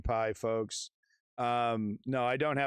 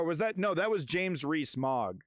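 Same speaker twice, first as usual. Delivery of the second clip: normal talking voice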